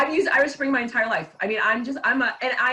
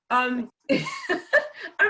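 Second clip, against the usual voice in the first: laughing; 10 semitones higher, much slower than usual. Real, not acted. Laughing over the compliment.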